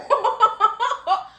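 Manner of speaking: loud, high burst of laughter; laughing. Real, not acted. Host cracking up at the rooftop kick, loud laughter.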